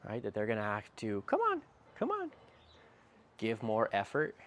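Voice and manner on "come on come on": squeaky high come-on, rising; high coaxing encouragement. Retold or imitated. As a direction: coax a dog, pitch way up. Urging dog to give effort.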